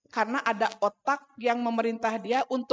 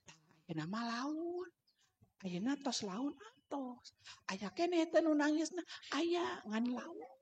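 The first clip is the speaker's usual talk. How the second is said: slow, hushed parental voice; very soft, drawn-out reassurance; softened voice; 3 semitones higher, 10 dB quieter than usual. Retold or imitated. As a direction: murmur slowly to soothe a crying child. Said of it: "Jadi suara saya tuh dipelankan".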